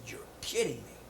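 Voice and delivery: hearing who the famous player is, whispered amazement; awed whisper. Retold or imitated. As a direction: breathe it out, stunned. Speaker amazed at the news.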